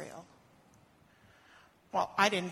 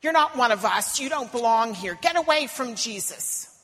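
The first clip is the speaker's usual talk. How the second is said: loud, hostile crowd voice; angry shout; 2 semitones higher, 8 dB louder than usual. Acted. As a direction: drive her off. Crowd driving her away.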